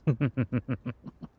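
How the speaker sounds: rapid, rippling evil chuckle; short, gloating vampire laugh; villainous chuckle. Acted. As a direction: chuckle darkly at your own scheme. Scheming vampire relishes the plot.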